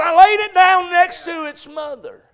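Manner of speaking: loud, high-pitched holler; preaching shout. Retold or imitated. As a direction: holler it out from the pulpit. Preacher shouting out the retold story.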